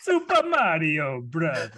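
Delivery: slow, hammed-up Mario-style Italian; mock Italian accent. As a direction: play it like Super Mario, laughing. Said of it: "He's still Italian".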